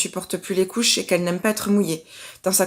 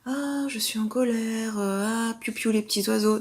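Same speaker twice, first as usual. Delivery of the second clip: soft, drawn-out airy singsong parody of kindness; mock serene; 4 dB quieter than usual. Mother mocking forced kindness to another mother, mock serene. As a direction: put on a dreamy, slow, birdie-happy calm. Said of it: "la bienveillance, ce sera pas forcément de se dire".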